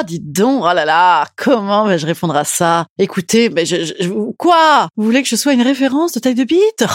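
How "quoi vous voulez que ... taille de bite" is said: high, indignant disbelief; shocked. Real, not acted. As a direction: react like you can't believe it. Shocked reaction to question.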